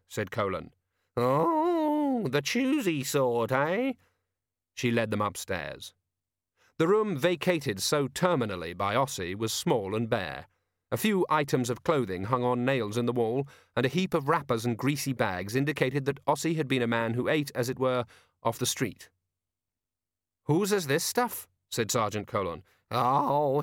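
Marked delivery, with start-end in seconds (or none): 1.2-3.9 mocking. Landlady mocking choosy lodgers. 20.4-21.5 landlady voice. Acted.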